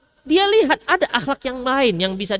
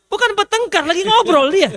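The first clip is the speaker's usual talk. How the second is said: Medan bluntness; 7 semitones higher than usual. Retold or imitated. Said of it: "Yang Medan kenceng suaranya".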